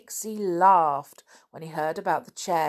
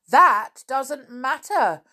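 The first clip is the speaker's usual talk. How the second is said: slow, high, loud crowd retort; angry shout; 7 semitones higher, 7 dB louder, slower than usual. Acted. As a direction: shout it back, leaning on each word. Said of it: "shouted the little folk, looking angry".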